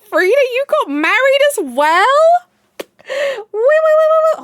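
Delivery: very high, loud cry of surprise; can't believe the news, shrieking; shocked excited squeal. Real, not acted. Hears a friend got married.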